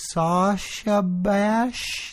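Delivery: very slow, unsure "Sasha Bash", rising; testing a word on a GPS, slow and unsure; tentative. Retold or imitated. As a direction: spell it out hesitantly to the machine. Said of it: "all, like, tentative".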